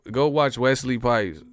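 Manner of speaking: normal talking voice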